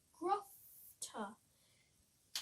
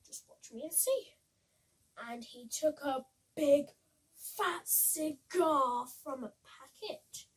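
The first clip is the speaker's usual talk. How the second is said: put-on lively read-aloud for children; bouncy rhyming picture-book voice; storybook reading; 6 dB louder than usual. A narrator's reading.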